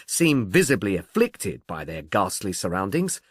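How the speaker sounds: ordinary delivery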